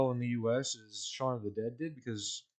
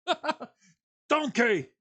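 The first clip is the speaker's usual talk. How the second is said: bad Scottish impression; 13 semitones higher, 7 dB louder, much slower than usual. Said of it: "my impression is".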